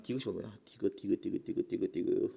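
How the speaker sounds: low, soft repeated murmur; absent-minded muttering. Real, not acted. Teacher hunting for option 디귿, muttering low to self. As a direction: mumble it to yourself while searching.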